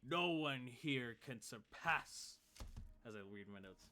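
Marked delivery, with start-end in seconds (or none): 0.0-2.4 boastful showman. Acted. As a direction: show off like a champion. Half-orc boasts to crowd.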